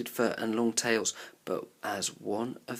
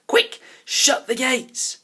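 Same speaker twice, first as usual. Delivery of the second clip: loud, higher, slow command; urgent shout; 13 semitones higher, 11 dB louder, slower than usual. A narrator's reading. A child shouts to shut the gates on the leopard, loud and urgent. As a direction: order the gates shut at once. Urgent order during chase.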